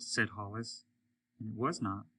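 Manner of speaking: ordinary delivery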